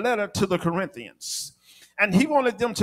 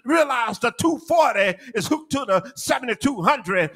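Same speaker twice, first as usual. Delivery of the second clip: high, driving sermon delivery, phrases run together; full-force preaching; 5 semitones higher than usual. Real, not acted. Preacher telling church they need kingdom power, full-force preaching. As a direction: build to an urgent pulpit shout.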